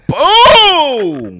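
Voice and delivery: high, loud, drawn-out shout; triumphant boom. Pretend. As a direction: boom it out after a mic drop. Celebrates a mic drop.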